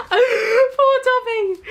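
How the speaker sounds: high, slow, drawn-out "Poor Dobby"; mock pity.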